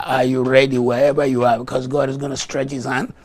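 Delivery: ordinary delivery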